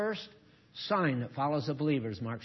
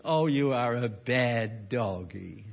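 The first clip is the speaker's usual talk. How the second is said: 1 cooing scold; 4 semitones lower, much slower than usual. Retold or imitated. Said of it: "You know?".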